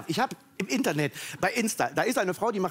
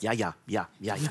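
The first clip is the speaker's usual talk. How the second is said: low, murmured string of agreeing yeses; grandma murmuring; 9 semitones lower, 3 dB quieter than usual. Retold or imitated. Grandma agreeing with the TV, a low murmured string of yeses. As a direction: mumble along like an old lady at the TV.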